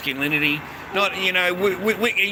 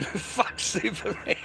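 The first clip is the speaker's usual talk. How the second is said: laughing; 2 semitones higher, 8 dB quieter, slower than usual. Shown as a demonstration. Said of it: "laughs while repeating".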